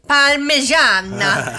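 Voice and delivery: put-on loud, drawn-out, over-the-top Italian accent; mock Italian accent. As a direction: ham up the Italian pronunciation. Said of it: "like when people are talking about a restaurant".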